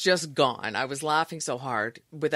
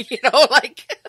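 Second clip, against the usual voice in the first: laughing; 9 semitones higher, 13 dB louder than usual. Real, not acted. Recalling embarrassing on-air moment.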